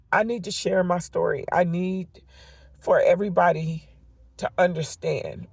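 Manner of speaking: normal talking voice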